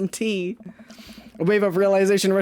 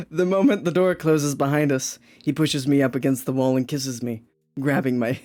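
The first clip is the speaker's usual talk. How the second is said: low, steady reading voice; story narration; 7 semitones lower than usual. Acted. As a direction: read it out as narration.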